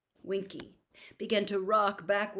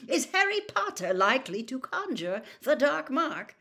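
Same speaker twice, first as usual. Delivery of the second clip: raised, indignant challenge; very angry; 7 semitones higher, 4 dB louder than usual. A narrator's reading. Father defends the boy to his colleague, indignant. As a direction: throw the question at him angrily. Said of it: "very angrily".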